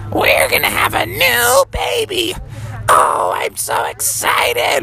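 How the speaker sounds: very high, slow falsetto; thrilled high-voiced mother, words stretched; Froggy's mom. Mom announces a new baby, high excited voice. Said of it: "Froggy's mom said".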